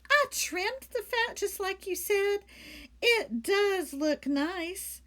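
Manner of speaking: proudly, high, drawn out at end; high, proud maid voice. Acted. Amelia Bedelia character voice.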